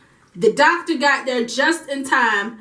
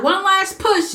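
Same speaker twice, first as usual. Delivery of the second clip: higher, encouraging push call; coaching midwife; 7 semitones higher, slower than usual. Retold or imitated. Midwife urging the mother through the final push, higher. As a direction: urge one more push, pitched up. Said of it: "And she was like".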